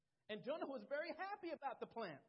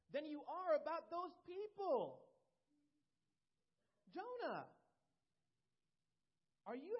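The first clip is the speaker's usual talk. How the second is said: higher and louder, earnest divine appeal, slowing at the end; raised preaching voice of God reasoning with Jonah; God pleading with Jonah; 6 semitones higher, 3 dB louder than usual. Acted. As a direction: plead as God to a stubborn prophet.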